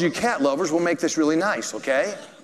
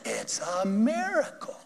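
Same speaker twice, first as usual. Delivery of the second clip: awed; 5 semitones higher, 5 dB quieter, slower than usual. Retold or imitated. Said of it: "And she said".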